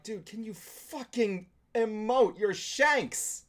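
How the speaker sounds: high, drawn-out exasperated demand; exasperated plea. Retold or imitated. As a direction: plead with the actor, stretching the key words. Exasperated plea aimed at the actor.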